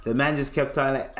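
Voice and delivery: ordinary delivery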